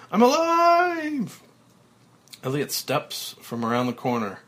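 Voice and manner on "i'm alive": very high, loud, slow joyful shout. Acted. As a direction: shout the joy to the rafters. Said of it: "finally shouting with joy".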